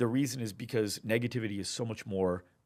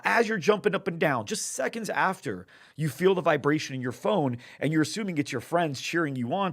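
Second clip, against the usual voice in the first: higher and louder, still celebrating; excited narration; 5 semitones higher, 5 dB louder than usual. Retold or imitated. Setting the scene of the fan jumping up and down, excited. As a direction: keep the party energy going.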